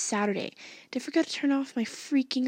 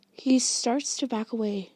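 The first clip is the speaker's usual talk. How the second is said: ordinary delivery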